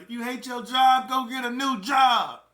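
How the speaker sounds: loud, high-pitched mocking yell; imitated shouting. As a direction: shout it like a fed-up prison yeller, dragging out the end. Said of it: "That's how they say it".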